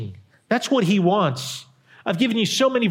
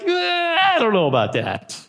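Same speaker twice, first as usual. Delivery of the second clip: mock reluctant shrug; 9 semitones higher than usual. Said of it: "we're like".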